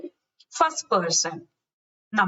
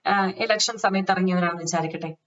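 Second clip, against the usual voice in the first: ordinary talk, nothing marked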